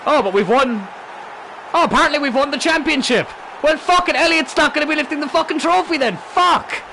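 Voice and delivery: very high, loud, stunned yelling; high-pitched, sweary eruption of surprise; shocked outburst. Real, not acted. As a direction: shout it out in real disbelief at the win. Player reacts to unexpectedly winning the championship.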